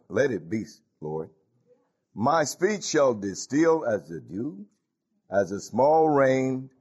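slow, solemn scripture read; solemn reading to the congregation. Acted. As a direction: let the verse fall slowly like rain. Reads scripture in sermon.

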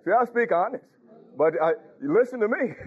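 usual speaking voice

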